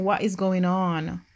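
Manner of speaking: ordinary talk, nothing marked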